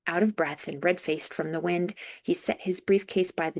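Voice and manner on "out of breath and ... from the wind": ordinary delivery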